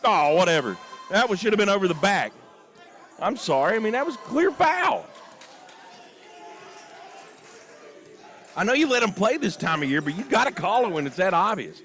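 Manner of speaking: exasperated, high-pitched griping at officiating; worked-up commentator venting; emotional complaint. Real, not acted. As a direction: keep arguing the missed call, a notch below yelling. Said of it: "I get a little emotional".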